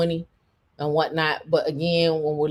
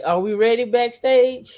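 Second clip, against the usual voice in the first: higher, louder call to backstage; 6 semitones higher, 5 dB louder than usual. Real, not acted. Host checks with the backstage crew, calling out.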